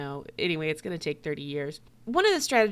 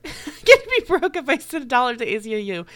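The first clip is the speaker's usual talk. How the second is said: louder, laughing through the words; 5 semitones higher, 8 dB louder than usual. Real, not acted. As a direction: laugh as you mock the complaint. Laughs mimicking broke complaint.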